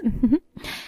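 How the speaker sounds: short smug laugh; little laugh of triumph; gloating chuckle. Acted. Daughter-in-law signs off on cornered mother-in-law, gloating chuckle.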